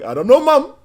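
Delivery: high, loud cartoon bear voice; hammed-up cartoon bear confession; Yogi Bear. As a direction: answer mom as a cartoon bear. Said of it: "Talking like Yogi Bear".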